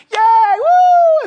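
high, loud whooping cheer; loud, high festive yelling; mock crowd cheer. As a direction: cheer like the Palm Sunday crowd. Crowd cheering Jesus on the donkey.

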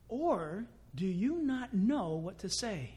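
ordinary delivery

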